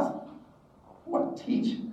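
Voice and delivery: soft, slow, drawn-out and breathy; incredulous musing. Retold or imitated. Student mulls over teaching career, incredulous. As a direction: mull it over in mock disbelief.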